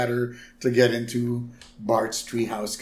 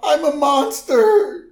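high, loud, slow laughing wail; mock wailing Lisa; 12 semitones higher, 7 dB louder, slower than usual. Lisa complains to Homer about the costume, high mock wail. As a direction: whine it like a heartbroken kid.

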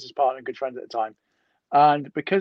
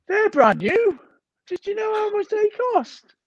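much higher, outraged doctor voice; a doctor appalled at his broken scales, much higher; shocked doctor. Retold or imitated. Doctor to heavy patient, outraged and much higher.

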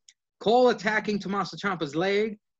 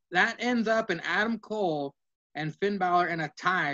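ordinary delivery

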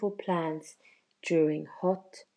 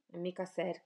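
ordinary delivery, nothing marked

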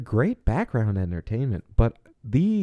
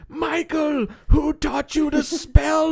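Doctor Loomis voice; slower than usual. Retold or imitated. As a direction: yell it like a frantic doctor. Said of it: "he's just like".

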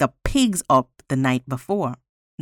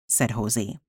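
plain reading voice

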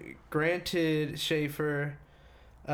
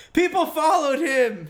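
loud, high disbelieving outburst; shocked exclaim; 11 semitones higher, 9 dB louder than usual. Real, not acted. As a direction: blurt it out in shock.

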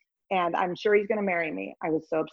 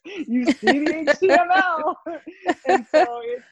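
laughing; 11 semitones higher, 5 dB louder than usual. Real, not acted. Joking about seeing like Neo.

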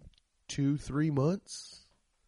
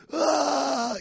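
short, much higher mock cry of pain; mock scream; 11 semitones higher, 4 dB louder than usual. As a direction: fake a brief agonized yelp. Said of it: "You're not like".

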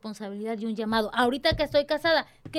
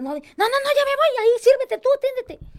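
hurried brush-off; 11 semitones higher, 6 dB louder than usual. Acted. Rushing out, telling husband serve himself.

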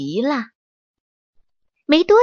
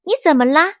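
surprised question; 6 semitones higher, 4 dB louder, much faster than usual. Acted. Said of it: "小猴惊讶地问".

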